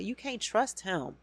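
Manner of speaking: ordinary talk, nothing marked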